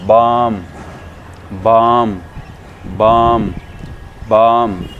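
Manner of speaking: बाम loud, with a held "aa"; loud, evenly spaced model takes of बाम, long vowel; long aa sound. Shown as a demonstration. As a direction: stretch बाम into "baaam". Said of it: "इसको बोलते हैं".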